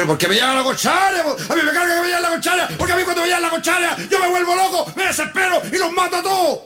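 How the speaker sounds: high, escalating mock-furious shout; hammed-up maniac losing control; crazed rant.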